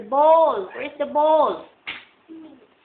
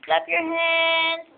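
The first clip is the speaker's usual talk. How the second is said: slow stretched; much slower than usual. Shown as a demonstration. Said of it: "shows clapping to toddler".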